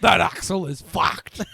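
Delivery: loud, slow, laughing mock outrage; hammed-up mechanic rant. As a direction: drag it out like a scandalised tradie. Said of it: "He's like".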